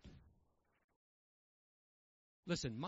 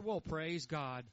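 sarcastic; 9 semitones lower than usual. Pretend. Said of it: "I mean, I didn't say that".

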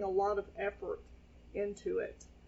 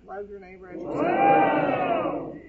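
booming, slow, much higher and louder proclamation; Jesus impression; 7 semitones higher, 10 dB louder, slower than usual. Pastor booming the line as Jesus would. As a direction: proclaim it like a prophet over a crowd. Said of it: "That would be Jesus saying that".

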